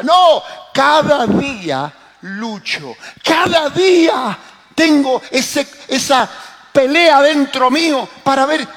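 high, loud, slow preaching; prédica a plena voz. Acted. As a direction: proclaim it forcefully, stretching the key words. (predicando con fuerza, alto y pausado)

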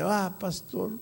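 mock sob of a remorseful old man; imitated crying. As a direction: wail it lightly, imitating tears. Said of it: "comenzó a llorar y dice".

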